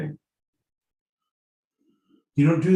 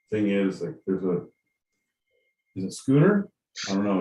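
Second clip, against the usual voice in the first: normal talking voice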